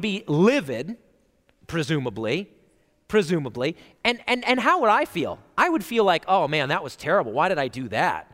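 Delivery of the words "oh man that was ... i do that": low, quick self-reproach; imagined remorse, muttered low and fast; regretful. Pretend. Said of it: "I'd feel like I did something wrong".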